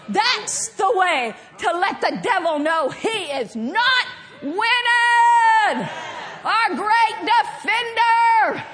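full-voiced, driving sermon delivery; forceful preaching. Real, not acted. Preacher rallying church against the enemy, forceful preaching. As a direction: preach it hard, phrase after phrase.

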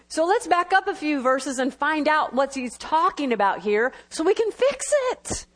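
ordinary talk, nothing marked

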